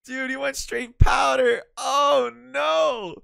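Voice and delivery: loud, high-pitched disgusted yelling; shocked shout. Real, not acted. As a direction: yell it out in horror. Reacting to friend doing powder.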